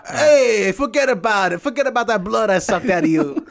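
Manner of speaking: New York vampire, forget about it; New York accent. Retold or imitated. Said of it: "the Long Island vampire".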